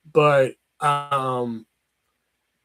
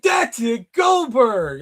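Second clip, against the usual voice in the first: excited recognition; 8 semitones higher, much slower than usual. Retold or imitated. Uncle thrilled the nephew guessed right, shouting. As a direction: shout it like you finally got it.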